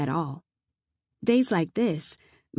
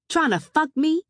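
high, loud, rising retort; sharp indignant shout; indignant accusation; 9 semitones higher, 5 dB louder than usual. Acted. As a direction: tell on him, raised and outraged. Indignant accusation called to housemate.

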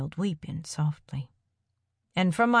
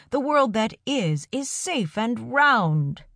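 raised, slow voice with a smile; called out with a smile; 6 semitones higher, 3 dB louder, slower than usual. A narrator's reading. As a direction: call it out slowly, smiling. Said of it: "he called out, a smile in his voice".

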